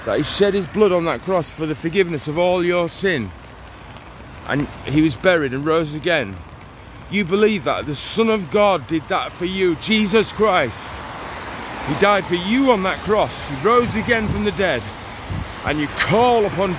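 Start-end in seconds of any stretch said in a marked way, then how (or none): none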